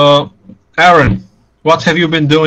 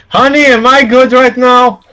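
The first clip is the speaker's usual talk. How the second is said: high, loud call to a spouse; raised, pitched-up holler for honey; calling to wife; 9 semitones higher, 5 dB louder than usual. Acted. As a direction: call out to your wife in the next room. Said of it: "Yeah, I should ask".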